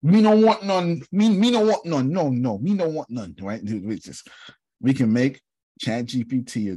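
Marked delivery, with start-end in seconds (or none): 0.0-3.3 put-on Jamaican accent. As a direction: play a Jamaican woman, loud and playful. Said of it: "make this machine a Jamaican woman with a Jamaican accent".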